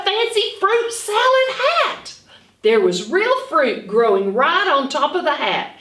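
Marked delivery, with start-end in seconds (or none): 0.0-2.2 delighted reveal. A narrator's reading. Reveal of fancy hat.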